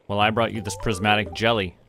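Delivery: usual speaking voice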